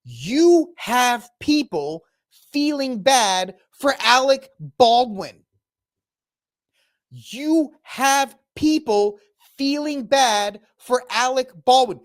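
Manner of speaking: slow, deliberate, each word spaced; slow emphatic repetition; said slowly. Shown as a demonstration. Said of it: "I will say this again slowly".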